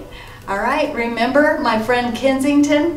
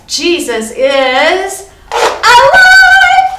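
big, high, slow joyful shout; excited cheer; 10 semitones higher, 13 dB louder, much slower than usual. Shown as a demonstration. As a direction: cheer it out long and loud for the kids. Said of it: "but you can go".

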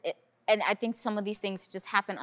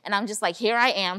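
ordinary delivery

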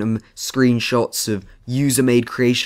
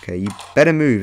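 ordinary talk, nothing marked

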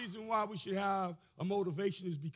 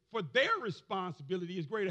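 ordinary delivery

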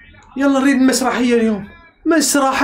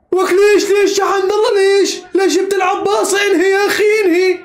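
exasperated shouting; 4 semitones higher than usual. Real, not acted. Fan yelling at Hamdallah to finish the chance, exasperated shouts. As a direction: raise the pitch and shout at the player.

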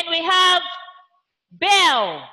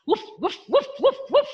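dog barks; 6 semitones higher, much faster than usual. Shown as a demonstration. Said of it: "A dog barks".

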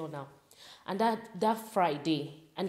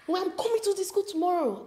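angry dad; 11 semitones higher than usual. Retold or imitated. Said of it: "he turns red".

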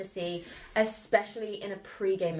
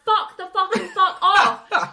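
higher, louder, slow mock villager shout through laughter; mock yell; 11 semitones higher, 12 dB louder, much slower than usual. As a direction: bellow it back at her, laughing.

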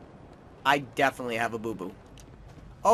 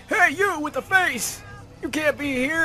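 imitating a cop; 13 semitones higher than usual. Gamer voicing a cop yelling at him, high mock cop. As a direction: squeak out a cartoon cop order. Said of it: "Cops are gonna be like".

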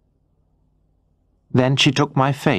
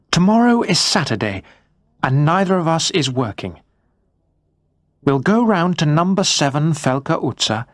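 higher, brisk wife's voice, upbeat and decided; determined wife; 5 semitones higher than usual. Acted. Wife planning tomorrow's questions with her husband, determined. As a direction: lay out the plan with cheerful resolve. Andrea makes a confident plan.

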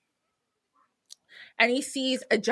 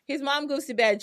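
usual speaking voice

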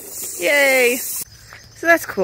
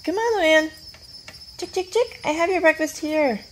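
high coaxing sing-song call; calling chickens; 8 semitones higher than usual. Real, not acted. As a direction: coax the hen in a high voice. Coaxing hen to follow.

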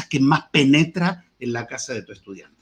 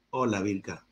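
much softer, low greeting; 8 semitones lower, 12 dB quieter than usual. Shown as a demonstration. Teacher demoing prosody, a hushed hello. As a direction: let the hello fall quiet and low. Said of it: "Eso es prosodia".

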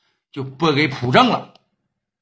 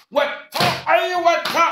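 pained cry; 12 semitones higher than usual. Acted. Hit by a bottle.

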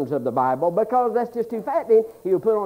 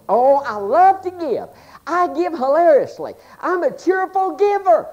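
mock eager giver; 7 semitones higher, 4 dB louder than usual. Eager believer boasts about giving.

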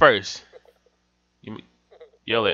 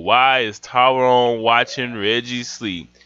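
ordinary delivery

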